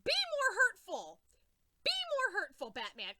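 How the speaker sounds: high, loud indignant scolding; outraged scold. Real, not acted. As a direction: scold him loudly. Scolding Batman.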